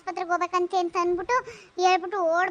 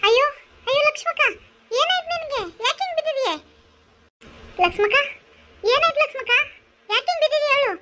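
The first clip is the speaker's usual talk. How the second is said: high-pitched worried neighbour; high, urgent, alarmed voice; alarmed concern; 7 semitones higher than usual. Acted. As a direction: fire anxious questions at a fallen friend. Neighbour finds woman fallen, alarmed.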